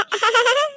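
put-on high, bright little girl's giggle; child's giggle. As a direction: giggle cheekily like a small girl. Child laughs at grandma.

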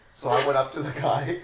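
normal talking voice

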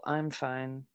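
low, soft, withdrawn "fine"; disappointed "I'm fine". Shown as a demonstration. Explaining the second example, passive-aggressive "I'm fine". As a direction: drop it quiet and low, hiding disappointment. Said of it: "I am so disappointed in you".

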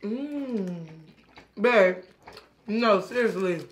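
speaking between bites; talking while chewing. Real, not acted. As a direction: mouth partly full. Seen 2: She bites the taco just before and keeps talking while still eating.

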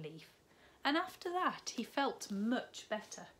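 put-on animated children's story narration; storybook read-aloud, building. A narrator's reading. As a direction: build the suspense for the little ones. Reads picture book to children.

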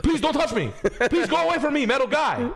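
high, loud, laughing mock panic; a hammed-up frightened passenger squeals; panicked yelp. Friend mimics panicked bus passenger, high laughing yelp. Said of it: "like, panicking".

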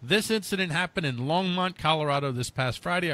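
normal talking voice

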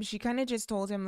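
normal talking voice

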